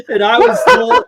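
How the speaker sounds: can barely get it out for laughing. Real, not acted. Laughing as he tells the hosts about the director.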